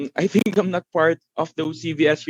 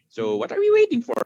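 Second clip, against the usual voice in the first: high, eager host hype; excited; 12 semitones higher than usual. Real, not acted. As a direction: pump up the audience. Hyping the raffle draw.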